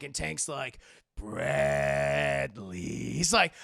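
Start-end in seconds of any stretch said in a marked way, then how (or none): 1.2-2.8 very low, loud, drawn-out name; menacing growl. Retold or imitated. Betrayed Tank confronting Bradley, menacing low growl. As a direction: growl it like a threat.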